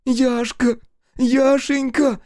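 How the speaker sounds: very high, desperate cry. A narrator's reading. Kira clutches narrator's legs, crying out. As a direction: cry the name out, drawn out and high. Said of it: "плакала Кира, цепляя меня за ноги".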